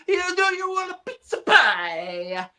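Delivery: high, drawn-out mock Italian accent. Joking when Italian friends come over, Italian accent. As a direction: ham up a high Italian accent, stretching the end. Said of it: "when my Italian f-, friend".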